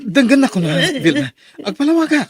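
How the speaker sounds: high, loud begging; panicked high pleading; frantic pleading. Acted. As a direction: plead loudly for mercy. Husband pleading loudly in fight.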